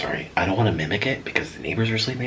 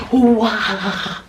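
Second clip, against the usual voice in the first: high-pitched, louder mock scream; imitated screaming; 13 semitones higher, 5 dB louder than usual. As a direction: let out a mob's wail. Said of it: "all of them start screaming".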